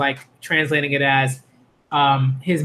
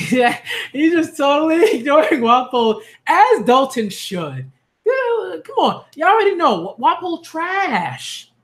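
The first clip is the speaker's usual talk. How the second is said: excited laughing rant; 7 semitones higher, slower than usual. Real, not acted. Laughing rant about Wapo.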